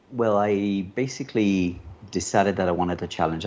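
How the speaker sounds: ordinary delivery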